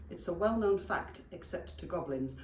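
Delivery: plain reading voice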